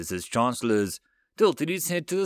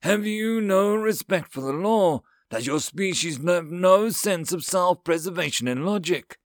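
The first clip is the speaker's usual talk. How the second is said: high, raised, indignant alien voice; fuming anger; 7 semitones higher, 4 dB louder than usual. A narrator's reading. Alien counselor berating humans over the law, fuming. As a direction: berate them as if outraged by their folly. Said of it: "through the fuming anger".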